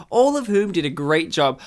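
usual speaking voice

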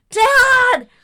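high frantic call to dad; panicked yell. Retold or imitated. New mother yells for her dad from the bathroom, panicked. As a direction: scream for your father in alarm.

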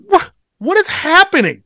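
high-pitched, exasperated disbelief; bewildered protest. Retold or imitated. Driver reacting to birds diving at his car, high bewildered protest. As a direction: pitch it way up, pleading for an explanation.

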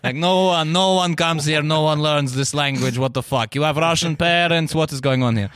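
lightly voiced suspicious Russian accent; a wary Russian sniffing out a spy; a hint of a suspicious Russian accent. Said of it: "They're like".

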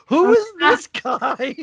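high, slow, drawn-out disbelief; mock-baffled viewer; incredulous. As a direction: ask it slowly in high-pitched amazement. Incredulous reaction to new character.

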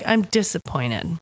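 ordinary talk

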